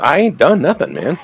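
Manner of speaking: high-pitched, indignant mock protest; retold plea of innocence. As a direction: protest as the wronged man would. Retold man pleads innocence.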